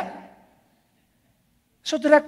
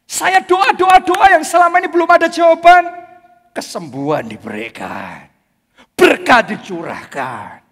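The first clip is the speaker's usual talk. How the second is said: rising testimony; 7 semitones higher, 7 dB louder than usual. Real, not acted. Testimony climax.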